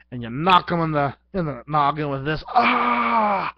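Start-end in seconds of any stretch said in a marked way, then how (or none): 2.5-3.5 high loud mock scream of pain. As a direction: yelp like you just got bonked. Victim hit in the head.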